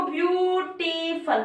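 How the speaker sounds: ब्यूटीफुल drawn out as ब्यू-टी-फुल; ब्यूटीफुल in long, separate syllables. Shown as a demonstration. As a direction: hold each syllable of ब्यूटीफुल so it can be counted. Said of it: "sound ना दो से अधिक निकल रही है".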